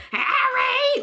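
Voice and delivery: high, drawn-out mimicked holler; imitated call-out. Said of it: "she just said".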